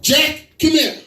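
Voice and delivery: high-pitched mom voice; hammed-up high maternal warning; mother warning child. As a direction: call the child over and threaten him. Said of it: "she introduced me as such to the child".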